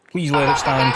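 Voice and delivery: begging the goal to count; pleading with the referee. Real, not acted.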